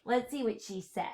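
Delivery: ordinary delivery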